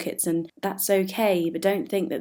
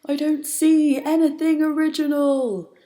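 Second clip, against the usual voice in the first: shouting; 10 semitones higher, 7 dB louder than usual. Acted. Poet mimicking a creator in the green room, shouting. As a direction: shout it out in complaint.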